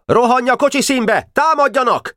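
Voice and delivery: very high, urgent battle order; shouted command. A narrator's reading. Boka sending Kolnai to launch attack, shouted command. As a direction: send the attack in with a high cry.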